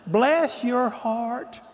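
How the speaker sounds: very slow, drawn-out Southern pity; Southern mock pity. Southern mentor seeing a colleague's unruly class, drawn-out mock pity. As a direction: stretch every word in imitated Southern condescension. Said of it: "when a Southern woman says".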